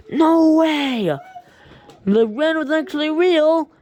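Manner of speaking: high, loud cry of disbelief; amazed shout. Retold or imitated. Boy stunned that Ren is real, high loud amazed shout. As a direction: shout it like you can't believe your eyes. Kid amazed in his dream.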